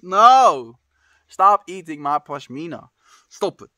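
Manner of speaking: loud startled scolding; shouting. Real, not acted. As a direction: yell at the pet to stop. Scolding pet eating scarf.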